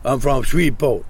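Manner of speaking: put-on a light Cajun accent; hint of Cajun accent. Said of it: "the Cajun accent".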